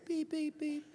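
high mock car alarm beeps; car beeping. Pastor imitating his car's seatbelt beep, high beeps. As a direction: chirp it like a seatbelt warning.

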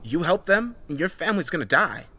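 arguing. A narrator's reading. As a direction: argue back with a heated threat. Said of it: "Heather argued".

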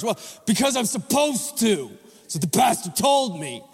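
lightly hammed-up reluctant believer; silly put-on voice. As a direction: play a dutiful churchgoer, a bit goofy. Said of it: "I don't know why I'm talking like this".

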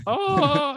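very high, drawn-out "oh"; high mock wail at normal volume; own wail re-enacted. Comic showing what he does at drops, high mock wail. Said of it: "I go".

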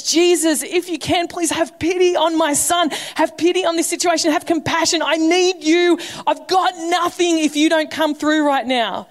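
high, urgent begging cry to Jesus; desperate pleading. Retold or imitated. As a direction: plead like a father at the end of his rope. Father implores Jesus for son.

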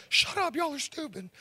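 high-pitched voice of an annoyed first grader; upset kid imitation. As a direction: mimic a kid telling off classmates.

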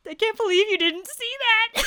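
incredulous teasing through laughter; laughing disbelief. Real, not acted. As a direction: squeal the words through a laugh.

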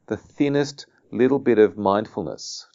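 ordinary talk, nothing marked

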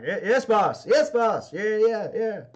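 cowed crew answering their boss; nervous henchmen. Acted. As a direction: hurry to agree with the boss.

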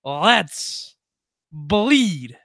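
slow, spaced-out catchphrase launch; showy announcer build-up. Pretend. Host launching the hockey show, slow announcer build-up. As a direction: announce it big, pausing before each last word.

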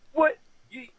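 high, loud mock "fuck you"; angry shout. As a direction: yell it back at him. Acted bar argument retold.

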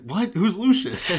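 loud, high-pitched confused shout; mock bafflement. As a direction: blurt it out, utterly baffled, play-acting. Retold sidekick baffled by rant.

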